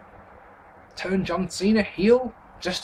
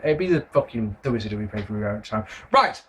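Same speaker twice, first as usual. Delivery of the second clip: ordinary delivery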